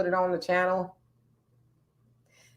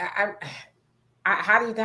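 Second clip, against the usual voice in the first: normal talking voice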